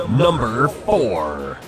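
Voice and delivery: slow, dramatic announcer voice; drawn-out countdown announcer call; top ten countdown. Acted. Top ten list countdown card.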